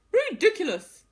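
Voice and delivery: high, loud, fast barking dog voice. Acted. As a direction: bark the words like a rowdy dog. Dog scoffs.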